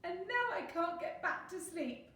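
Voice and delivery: high-pitched miserable complaint; tearful wail. A narrator's reading. Bear unable to sleep again, tearful wail. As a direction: lament it tearfully. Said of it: "cried the bear".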